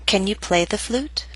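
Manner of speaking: "Can you play the flute?" rising on "flute"; rising intonation. Shown as a demonstration. Teacher models question to repeat, rising intonation. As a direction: lift the pitch at "flute". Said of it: "practice intonation patterns and questions".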